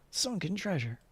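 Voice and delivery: normal talking voice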